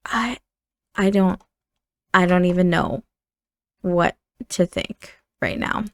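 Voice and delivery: halting, subdued, long pauses; lost for words. Acted. Sister left alone after the quarrel, lost for words. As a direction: falter softly, slowing as you go.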